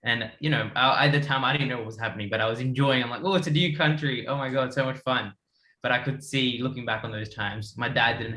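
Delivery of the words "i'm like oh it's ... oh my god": usual speaking voice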